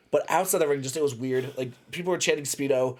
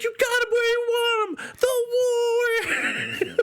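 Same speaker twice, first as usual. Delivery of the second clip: high, slow, crying-out cheer, much higher; mock cheering girlfriend; much slower than usual. Girlfriend cheering fighter ringside, high mock wail. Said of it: "she's like".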